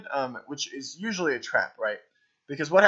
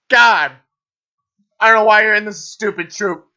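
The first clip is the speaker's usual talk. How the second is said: loud, higher mock scolding; mock anger; 6 semitones higher, 10 dB louder than usual. Coach scolding troupe for laughing: mock furious. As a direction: pretend to berate the giggling actors.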